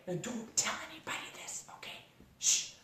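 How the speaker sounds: hushed conspiratorial whisper; secret whisper. Retold or imitated. Instructor tells Jeannie a secret, whispering. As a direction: whisper it like a secret nobody may hear. Husband's friend sharing a secret.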